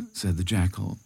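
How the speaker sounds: ordinary delivery, nothing marked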